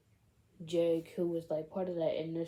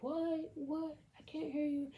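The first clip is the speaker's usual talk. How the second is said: much higher-pitched call at ordinary volume; mock calling back; 10 semitones higher than usual. Friend up on the bridge to those behind, mock calling back. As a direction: imitate straining to call back from afar. Retold reply called from afar.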